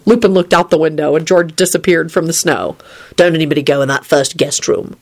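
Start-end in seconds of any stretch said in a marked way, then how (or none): none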